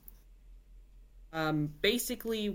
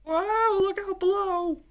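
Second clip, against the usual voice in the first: much higher, louder warning cry; shout; 13 semitones higher, 5 dB louder, slower than usual. A narrator's reading. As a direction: yell out a warning as they fall. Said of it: "shouted Boris".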